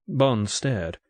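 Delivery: usual speaking voice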